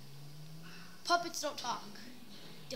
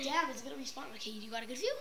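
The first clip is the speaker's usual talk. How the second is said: whispered; 3 semitones lower, 10 dB quieter, much faster than usual. Real, not acted. Quiet aside.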